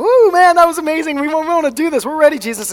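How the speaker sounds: high, loud, gushing excitement; whooping, hyped-up enthusiasm; fired up. Acted. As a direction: burst out like an over-eager follower. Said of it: "they're all fired up".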